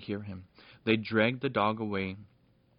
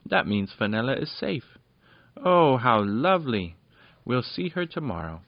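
glad that Fenella is safe; pleased; 4 semitones higher, 8 dB louder than usual. A narrator's reading. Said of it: "said Popoff, pleased".

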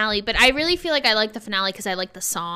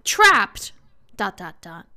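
ordinary talk

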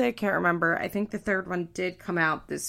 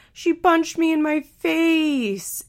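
high, drawn-out mocking voice, louder; 10 semitones higher, 5 dB louder, slower than usual. Host reads Amber mocking Andrew, high mocking voice. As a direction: mimic him in a slow, high, loud taunt. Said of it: "by mocking Andrew".

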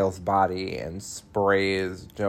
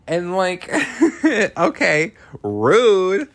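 higher, louder mock outrage; mock offended; 10 semitones higher, 7 dB louder, slower than usual. Real, not acted. Recapper mock-offended at the villain's insult. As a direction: act scandalised, laughing.

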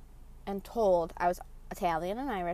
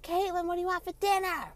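high-pitched impression of a nagging mom; mom calling out; 10 semitones higher than usual. Imitating mom calling about dinner.

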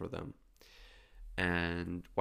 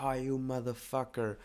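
higher, contained mock yell at someone; mock angry scream; 6 semitones higher than usual. Shown as a demonstration. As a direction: act out cursing someone, held back. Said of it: "like screaming".

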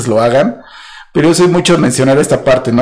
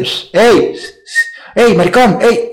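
street catcalling; 6 semitones higher, slower than usual. Retold or imitated. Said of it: "él le podía decir".

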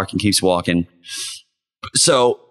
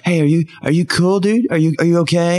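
lightly pitched-up concerned regular; a hint of fussy worry, a touch higher; mock concern; 7 semitones higher, faster than usual. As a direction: bar regulars checking on him, lightly higher.

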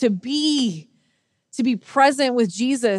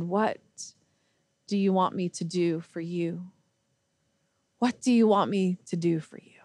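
soft, lowered, tender question; gentle asking; 3 semitones lower, 5 dB quieter than usual. Retold or imitated. Jesus standing before the blind man, gently asking. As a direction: ask softly, dropping almost to a whisper.

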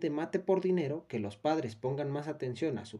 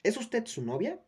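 questioning nurse; 7 semitones higher than usual. A narrator's reading. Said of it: "preguntó Esperanza".

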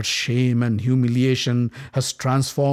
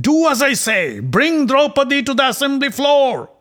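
much higher, loud angry yell; impatient yell; 13 semitones higher, 6 dB louder than usual. Acted. Prince yelling at the frightened messenger, loud and furious. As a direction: yell the order in a fury. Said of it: "He'd yelled at Pratikami".

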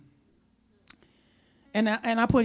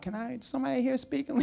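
lost tourist; 5 semitones higher, 4 dB quieter than usual. Retold or imitated. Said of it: "you be like".

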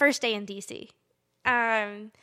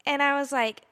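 ordinary talk, nothing marked

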